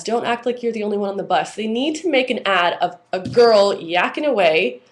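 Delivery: normal talking voice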